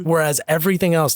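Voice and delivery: ordinary talk, nothing marked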